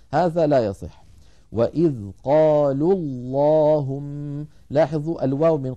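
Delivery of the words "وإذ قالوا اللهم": slow "وإذ قالوا اللهم", waw of قالوا dropped into the doubled lam; joined recitation. Shown as a demonstration. Teacher showing the dropped waw, "قالوا اللهم" slowly joined. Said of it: "سقط الأول من النطق وصلا".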